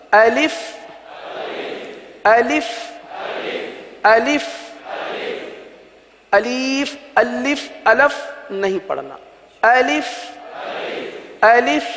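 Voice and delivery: "الف" said clearly as a-lif, short i; teacher's model reading of "الف"; correct pronunciation. Shown as a demonstration. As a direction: model "الف" slowly for students to repeat. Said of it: "الف کو اس طرح پڑھیں گے".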